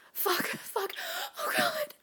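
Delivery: high, breathless exclamation; overwhelmed cry. Acted. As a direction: cry out between gasps, pitch leaping up. Character overwhelmed, crying out.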